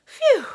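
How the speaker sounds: high, loud sigh of relief; relieved phew. Acted. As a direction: blow out a big relieved phew. Relief after checking the donuts.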